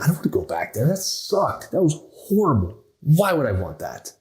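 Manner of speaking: disgusted. Retold or imitated. Retold rock bottom complaint.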